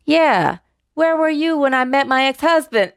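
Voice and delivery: sassy retort. Retold or imitated. Punchline of joke, wife's retort.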